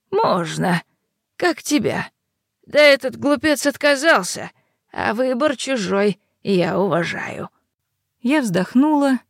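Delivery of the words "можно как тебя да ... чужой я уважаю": crone's sly reply; old hag. Acted. Yaga answers the heroine.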